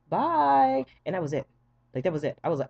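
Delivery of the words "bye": loud, high drawn-out bye; chirpy goodbye. Retold or imitated. Patient answering the nurse as anesthesia hits, chirpy. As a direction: sing out a silly high farewell.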